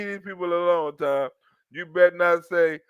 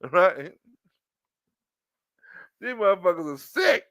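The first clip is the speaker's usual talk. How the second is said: usual speaking voice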